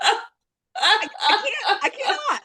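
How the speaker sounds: high, breaking into real laughter; can barely get it out for laughing; laughing helplessly. Real, not acted. Friend retelling her fall over a tree, laughing helplessly.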